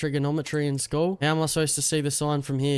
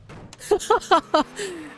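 shrill burst of giggling at the crash; high cackle; 4 dB louder than usual. Real, not acted. Laughing as the car drops into the ditch, high giggle.

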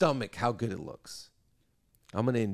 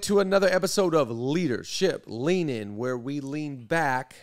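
loud, high announcer welcome; podcast host intro; 5 semitones higher, 8 dB louder than usual. Acted. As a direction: open the show with big energy. Opens the podcast episode.

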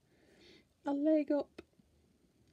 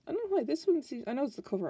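normal talking voice

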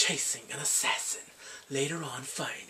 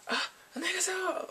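high quick greeting; much faster than usual. Retold or imitated. Father-in-law greets shyly.

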